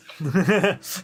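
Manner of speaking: big high burst of laughter; loud laugh. Real, not acted. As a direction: crack up loudly. Laughing at the photo choice.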